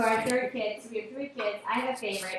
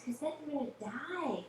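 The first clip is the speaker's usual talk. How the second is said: higher, softer mock-worried husband; mock nervous; 5 semitones higher, 6 dB quieter than usual. Nervous husband answering his wife about turning forty, mock nervous. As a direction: imitate a fretting spouse in a high, soft voice. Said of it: "my husband is crazy nervous".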